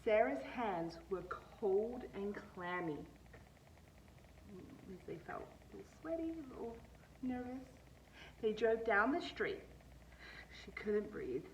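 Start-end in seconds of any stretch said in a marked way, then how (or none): 2.9-7.7 hushed, slow whisper; soft aside. A narrator's reading. Teacher aside to children, slow whisper. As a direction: lean in and whisper it.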